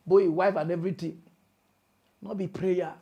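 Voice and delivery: ordinary talk, nothing marked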